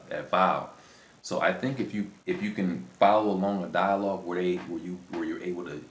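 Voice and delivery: usual speaking voice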